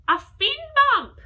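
high, loud triumphant reveal; excited reveal. A narrator's reading. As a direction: announce it like a eureka. Idea arrives.

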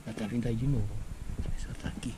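softly, low, watching for her; hushed aside. Real, not acted. Nephew checking whether his aunt is coming, hushed. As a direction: murmur it secretly to the camera. Aunt may overhear the setup.

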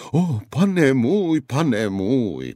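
high, loud, slow wail of pane můj; lamenting. A narrator's reading. The frightened guard begs the knight, lamenting. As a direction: wail to your master. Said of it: "bědoval muž".

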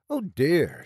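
marked concern. A narrator's reading. Irish nurse hears talk of suicide, marked concern. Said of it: "she said with marked concern".

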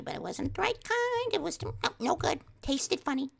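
very high mock-mom fussing; complaining mom. Mom telling of Emma's brownie, high mock complaint.

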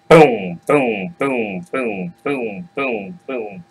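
heavy booms fading away; booming footsteps. Acted. Huge goat shakes the bridge, thudding booms. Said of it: "it sounds like it might damn near collapse".

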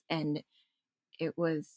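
ordinary talk, nothing marked

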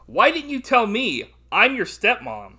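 high, loud, slow hammed-up reading; mock weird line. Host quoting the stepmom's weird line, hammed-up. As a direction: draw out the film line in loud mock disbelief. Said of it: "this was a weird line".

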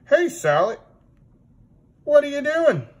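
high, puzzled puppet pony. Acted. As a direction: ask like a silly pony puppet. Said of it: "And Pablo the pony's like".

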